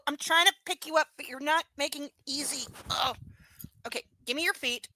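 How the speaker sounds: ordinary talk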